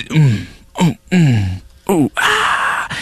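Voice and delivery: hammed-up groaning, rising into a squeal; mock pained groans. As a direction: play it as a fake painful birth, groaning. Acting out alien birth.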